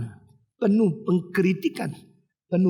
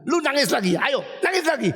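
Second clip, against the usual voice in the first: high-pitched threatening scolding; mock scary parent; 7 semitones higher than usual. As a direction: imitate a parent frightening a crying child. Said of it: "papa mama yang selalu menakut-nakuti anak". (menirukan orang tua menggertak anak)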